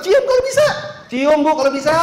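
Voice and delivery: loud, high-pitched mock challenge; exclaimed retort. Teacher answering why not kiss Hajar Aswad, exclaimed retort. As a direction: throw it back high and loud.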